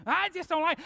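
high, loud whiny complainer; mock griper. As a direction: whine like a petty church griper. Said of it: "somebody else".

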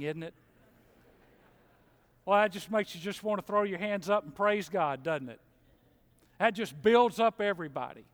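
ordinary talk, nothing marked

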